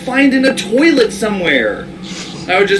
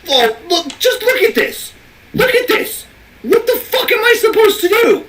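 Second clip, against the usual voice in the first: high frantic gamer yelling; frantic, pitched-up outrage; panicking gamer; 11 semitones higher than usual. Real, not acted. Frustrated shouting at the game.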